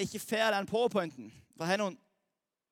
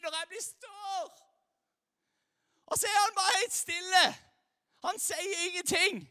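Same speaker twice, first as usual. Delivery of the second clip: put-on higher, pleading voice of a puzzled teen; frustrated young believer; 6 semitones higher than usual. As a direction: ask it like a kid who feels ignored by God. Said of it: "som alle kristne ungdommer lurer på".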